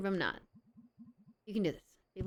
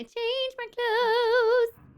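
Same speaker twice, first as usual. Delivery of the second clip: mock high voice; 7 dB louder, much slower than usual. Acting out character before party.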